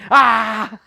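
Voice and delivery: a yelp dissolving into laughter; yelp, then crack up; laughing mock scream. Reviewer imitating the scream while cracking up.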